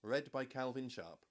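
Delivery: usual speaking voice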